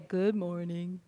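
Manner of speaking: low, slow, glum donkey drone; a parent quoting Eeyore to sleepy kids. Retold or imitated. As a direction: sound utterly hopeless, like Eeyore. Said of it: "I would quote them Eeyore from Winnie the Pooh".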